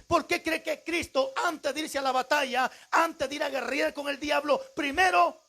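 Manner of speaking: high, forceful teaching with questions stretched out; preaching. Real, not acted. Preacher explaining fasting to congregation, forceful preaching.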